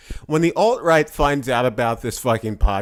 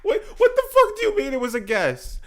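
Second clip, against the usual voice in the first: squeaky laughing protest; high laughing disbelief. Real, not acted. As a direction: protest while laughing hard.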